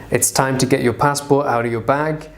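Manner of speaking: ordinary talk, nothing marked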